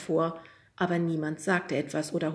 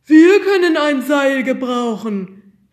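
high, loud and slow; eager shout; 8 semitones higher, 14 dB louder, slower than usual. A narrator's reading. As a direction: call out a bid across the room. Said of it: "rief Pekka".

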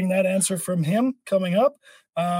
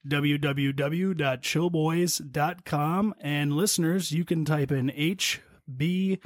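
ad read; 6 semitones lower, 4 dB quieter than usual. Acted. Sponsor ad read.

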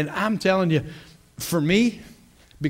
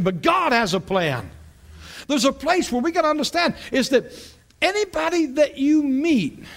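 preaching; 10 semitones higher than usual. Acted. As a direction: preach it out with fire. Opening at full preaching force.

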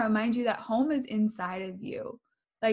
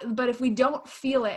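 ordinary delivery

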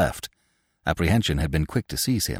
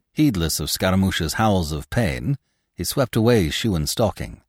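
plain reading voice

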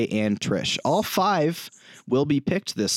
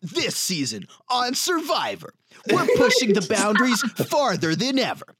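dramatic reality show hype; 10 semitones higher than usual. Pretend. Mock Survivor host promo.

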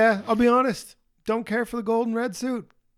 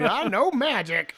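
slow, higher put-on lady voice; old Asgardian lady; 4 semitones higher, slower than usual. Host imitates the old Asgardian lady boasting of magic, slow and theatrical. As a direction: draw it out like a showy sorceress. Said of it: "dickhead old Asgardian lady".

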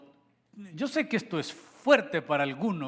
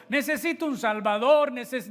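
mock eager seeker; 8 semitones higher, 6 dB louder than usual. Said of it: "No fuimos nosotros los que venimos corriendo".

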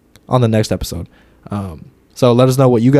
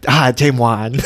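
mock imitation; slower than usual. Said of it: "I didn't say it like that".

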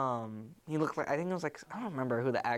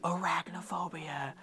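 hushed, spooky title reveal; dramatic whisper; 4 dB quieter than usual. Acted. Storyteller ends retelling with title, dramatic whisper. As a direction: breathe out the title like a trailer ending.

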